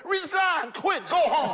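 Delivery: high, heated demand to resign. Real, not acted. Pastor ordering failing school board members out. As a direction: fire them off like orders.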